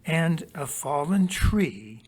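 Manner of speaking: slow, deliberate documentary read; slow nature narration. Acted. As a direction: read it like a nature film, unhurried. Reads documentary narration slowly.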